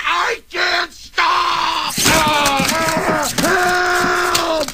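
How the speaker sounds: high, drawn-out mock scream; hammed-up, stretched-out cry for help; panicked scream. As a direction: scream it as the brakes fail. Acting out brake failure panic.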